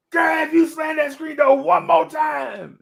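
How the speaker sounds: high, loud, drawn-out scolding shout; scolding holler at full voice; angry grandma yell. Acted. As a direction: yell a slow, stretched-out threat at a child. Acting out angry grandma yelling.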